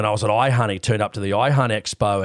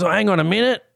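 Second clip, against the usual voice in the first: higher, mock double take; sudden recognition; 8 semitones higher, faster than usual. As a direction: play the stranger who just placed the face. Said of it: "He goes".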